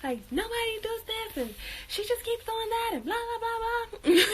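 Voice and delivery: ranting high-pitched and exasperated; a high, whiny rant about being fed up. Retold or imitated. To her friends online, a high exasperated rant. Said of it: "And I'm just like".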